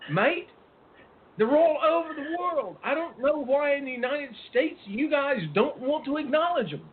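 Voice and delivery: put-on high-pitched, swooping Australian accent; best attempt at an Australian accent. Host imitating guest Jackson from Australia, high Australian accent. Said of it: "there's my best Australian accent".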